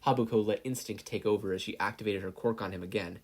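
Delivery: usual speaking voice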